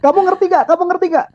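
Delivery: high, loud angry boss; shouting boss. Retold or imitated. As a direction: bark it at the zoned-out worker. Boss yelling at frozen worker.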